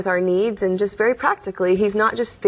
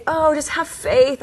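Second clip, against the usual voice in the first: lightly lilting, higher mom voice; slow, higher mock-motherly reassurance; imitating reassuring mom; 7 semitones higher than usual. Voicing mother's reassurance in story.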